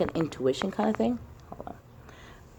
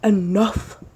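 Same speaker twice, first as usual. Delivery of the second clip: fed-up shout; 4 semitones higher, 6 dB louder, much slower than usual. Retold or imitated. Fed up oppressed voice.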